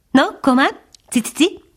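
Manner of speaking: high, chirpy little bird voice; tinkling titmouse. A narrator's reading. Titmouse teasing its dog pal, high tinkling chirp. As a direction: tinkle it out like a small tit. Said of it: "csilingelt a cinege".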